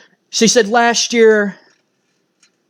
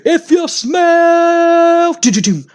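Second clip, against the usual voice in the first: high, slow shouted catchphrase, hummed filler; booming mock arena promo; The Rock catchphrase; 11 semitones higher, 4 dB louder, much slower than usual. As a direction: yell it like a wrestler hyping the crowd. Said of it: "you hear".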